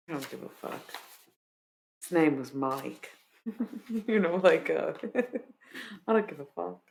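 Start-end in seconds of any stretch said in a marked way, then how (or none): none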